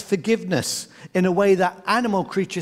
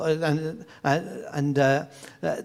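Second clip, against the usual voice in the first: normal talking voice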